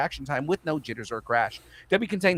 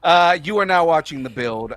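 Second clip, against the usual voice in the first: announcer; 5 semitones higher, 8 dB louder than usual. Acted. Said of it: "do every voice that I can".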